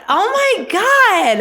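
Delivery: long squealed oh my god; drawn-out oh my god. Real, not acted. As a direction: stretch it out in mock shock. Reacting to her own joke.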